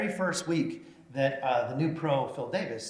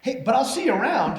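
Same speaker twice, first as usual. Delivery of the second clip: flirty woman's voice; 10 semitones higher, 8 dB louder than usual. Retold or imitated. The mayor quoting the woman's flirty offer, higher and louder.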